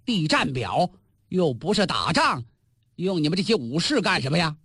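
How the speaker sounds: ordinary delivery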